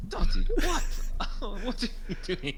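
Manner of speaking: high, loud startled outcry; alarmed disbelief. Acted. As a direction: exclaim in baffled alarm at a friend. Reacting to fog from friend's eyes.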